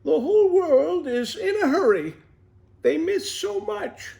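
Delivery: high, lightly voiced mother, slowing at the end; a gentle, lightly done mother's saying; a tortoise quoting his mother. A narrator's reading. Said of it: "My mother always says".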